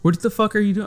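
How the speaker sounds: high-pitched, incredulous mock outburst; baffled driver's protest, played for laughs; exasperated disbelief. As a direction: retell it as a baffled, half-laughing demand. Exasperated retort retold to passenger.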